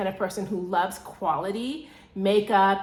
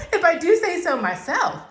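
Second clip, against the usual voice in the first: giggling through the words, higher and louder; laughing; 8 semitones higher, 6 dB louder than usual. Real, not acted. Bragging to viewers about her taste, laughing. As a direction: laugh while bragging a little.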